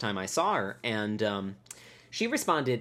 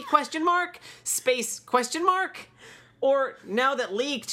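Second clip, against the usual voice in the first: much higher voice reading out mom's confused email; impression of mom; 9 semitones higher than usual. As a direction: read mom's email as a fussy high-voiced mom, punctuation and all. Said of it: "I'll try to do this as best as I can".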